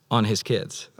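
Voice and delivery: ordinary talk, nothing marked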